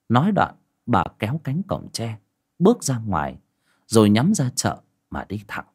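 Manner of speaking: plain reading voice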